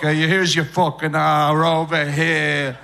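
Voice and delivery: put-on loud, slow New York accent. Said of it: "Drive down to New York".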